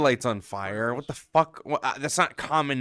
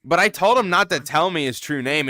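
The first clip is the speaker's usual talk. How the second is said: ordinary delivery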